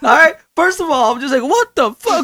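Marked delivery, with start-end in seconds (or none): 1.4-2.2 2 high, stunned what-the; 3 can't believe his eyes; 1 shocked disbelief. Retold or imitated. 4 man discovering his stuff stolen, high shocked outburst.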